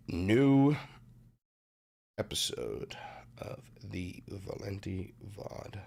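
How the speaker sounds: drawn-out deep intro announcer, slow and soft. Acted. Streamer announcing his new vod episode, lightly dramatic announcer. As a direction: announce the show title like a trailer.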